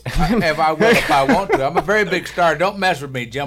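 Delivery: loud, laughing fake arrogance; mock big-star bragging. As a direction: brag like a pompous star, high and loud. Mock star boasting to host.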